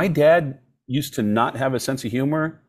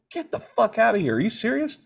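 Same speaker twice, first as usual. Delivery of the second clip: stunned disbelief; 10 semitones higher than usual. Retold or imitated. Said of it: "And I went".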